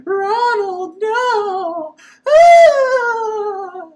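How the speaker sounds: high, loud lilting mock wail; sing-song fake cry. Reviewer mimicking Erica calling Ronald, high sing-song mock wail. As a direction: sing the cry out like bad dubbing. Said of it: "she says it exactly like that".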